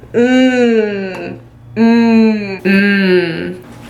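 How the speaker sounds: loud, drawn-out mmm of pleasure; appreciative hums. Real, not acted. Vlogger sniffing products, humming with pleasure. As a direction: savour each scent.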